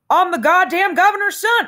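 high, loud, showy stage voice; hammy showman. Pretend. Holden hamming it up for his roommate, showy stage voice. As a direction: belt it out like a vaudeville hoofer. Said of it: "knocking myself out, tap dancing".

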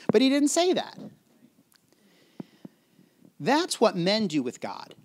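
ordinary talk, nothing marked

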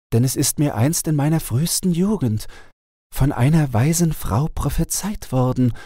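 the enchanted owl telling her sad tale; owl princess voice. A narrator's reading. Owl answers the caliph. (traurige Eulenprinzessin, etwas höher)

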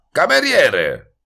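high, drawn-out call for service; shouting. A narrator's reading. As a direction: shout loudly across the room for the waiter. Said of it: "Voltò la testa e gridò". (grido acuto e prolungato)

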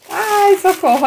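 high, excited little cry; thrilled, high-pitched gasp of words; delighted exclamation. Real, not acted. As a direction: cry out at a lovely surprise. Delighted at the next item.

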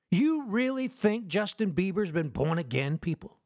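ordinary talk, nothing marked

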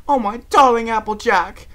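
high, loud, theatrical gushing; gushing gratitude. A narrator's reading. Rarity hugging the friend who rescued her, gushing loudly. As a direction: gush it out to your rescuer. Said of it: "she breathed".

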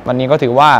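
ordinary delivery

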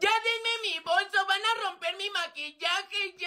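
put-on high-pitched whining girl; whiny complaint. Regina begging boys for her bag, whining. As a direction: complain in a high, pleading whine.

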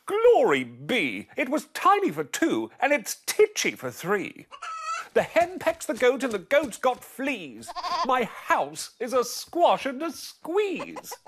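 put-on high, slow fretting old woman; old lady crying out. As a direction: wail it like a frazzled old lady. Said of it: "The little old lady cried".

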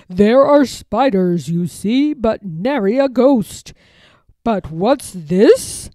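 a hint of a haughty lord; lightly voiced nobleman poking around; lord's voice. A narrator's reading.

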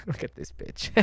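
whispered through laughter; laughing whisper. Real, not acted. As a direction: giggle it under breath.

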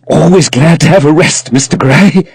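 breathless frame-maker, fresh off the stairs; gasping for breath. Acted. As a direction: puff out the thanks, winded from the stairs. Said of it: "who was still gasping for breath".

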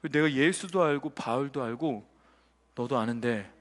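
very low, slow and soft demon; quiet demon voice. Acted. Demon singling out a listener in church, low slow menace. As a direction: intone it deep and hushed, word by word. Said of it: "이런 거예요".

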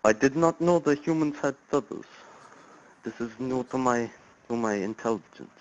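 lightly put-on Russian accent, stiff and formal; a hint of a fake Russian caller; weak mock Russian accent; bad Russian accent. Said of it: "That is the worst accent I think I've ever heard".

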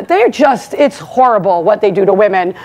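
ordinary talk, nothing marked

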